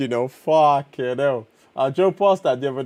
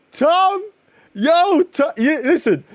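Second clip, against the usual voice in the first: calling out; 12 semitones higher, slower than usual. Real, not acted. Calling out to Tom.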